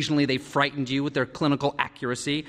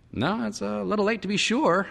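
amused; 9 semitones higher than usual. A narrator's reading. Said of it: "he said, amused".

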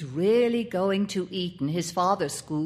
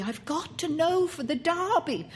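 high, feverish insistence; frantic reiteration; 9 semitones higher than usual. A narrator's reading. Boy reiterates to his uncle, frantic insistence. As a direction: repeat it urgently, pitched right up. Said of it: "the child reiterated".

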